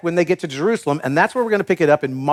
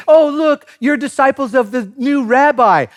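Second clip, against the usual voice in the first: hammed-up disciple boasting, pitched high; mock excited bragging; 7 semitones higher than usual. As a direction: gush like a proud fan. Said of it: "it's not gonna be".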